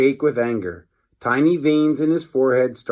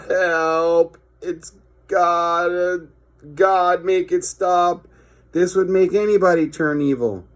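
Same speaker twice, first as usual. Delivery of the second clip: higher, pained mock distress; mock agony; 6 semitones higher than usual. A child suffers through the song, hammed-up agony. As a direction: beg for the noise to stop. Hearing the awful music.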